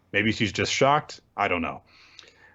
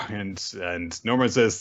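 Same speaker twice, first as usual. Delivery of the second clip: ordinary delivery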